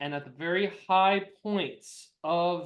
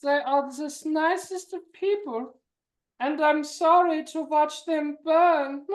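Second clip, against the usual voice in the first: high mock acceptance speech; high, slowing villain speech; award speech villain; 8 semitones higher than usual. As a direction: thank the Academy as a villain. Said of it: "I'd like to thank the Academy".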